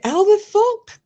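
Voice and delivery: real shocked outburst; incredulous yelp; disbelief. Real, not acted. Shocked that the alt is blocked.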